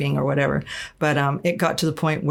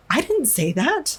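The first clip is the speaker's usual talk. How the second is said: much higher, slow indignant denial; defensive protest; 9 semitones higher, slower than usual. Retold or imitated. Retelling denying the bishop's claims, high indignant protest. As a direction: replay her disbelieving protest, pitched way up.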